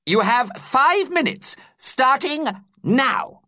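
high, very slow teacher announcement; teacher timing a test. A narrator's reading. Teacher starting the test clock, high and very slow. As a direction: draw out each word for the exam.

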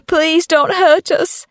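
high, slow, drawn-out plea; pleading woman, pitched right up; frightened mum's cry. A narrator's reading. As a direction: beg desperately in a high voice. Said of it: "her mum cried".

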